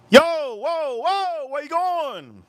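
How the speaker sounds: high, drawn-out "whoa" call; retold holler, high and stretched out; calling after someone. Calling after a fleeing friend.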